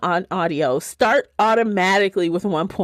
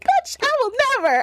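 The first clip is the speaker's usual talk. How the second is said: imitating Davina; faster than usual. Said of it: "He's like".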